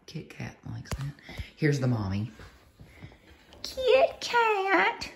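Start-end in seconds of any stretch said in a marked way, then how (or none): 0.1-1.1 soft unvoiced whisper; hushed aside next to the cats. Real, not acted. 3.6-5.1 very high squeaky cooing name. Real, not acted. Cooing to mother cat.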